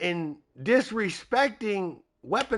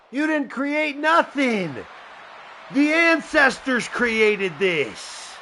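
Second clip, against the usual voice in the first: slow defiant shout; 6 semitones higher, slower than usual. Acted. Rival answering in the promo.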